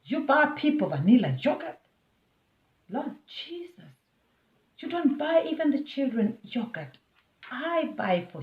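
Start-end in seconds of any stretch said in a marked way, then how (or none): 0.1-7.0 indignant retort. Retold or imitated. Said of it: "So I was like".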